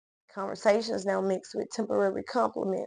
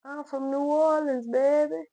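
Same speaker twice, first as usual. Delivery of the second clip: sing-out holler, pitched up but not full volume; mother's drunken holler; 6 semitones higher, 3 dB louder than usual. Acted. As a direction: imitate a drunk mother hollering hometown pride.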